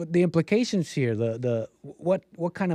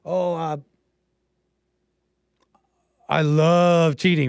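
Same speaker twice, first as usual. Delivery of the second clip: slow, louder, drawn-out mock confession; mock viral quote; 3 semitones higher, 4 dB louder, slower than usual. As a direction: parody her smugly, stretching it out.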